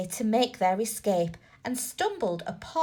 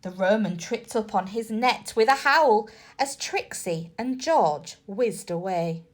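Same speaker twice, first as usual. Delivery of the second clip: usual speaking voice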